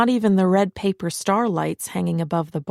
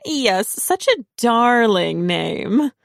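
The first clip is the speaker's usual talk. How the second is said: slow, higher, louder sugary put-down; fake sweet; 5 semitones higher, 5 dB louder, much slower than usual. A narrator's reading. Parker mocking Marissa's name, slow and sugary. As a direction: drag out the fake compliment.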